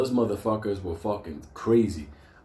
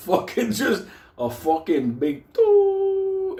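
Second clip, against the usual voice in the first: mock slow boom; 6 dB louder, much slower than usual. Gunshot flash, slow-motion boom.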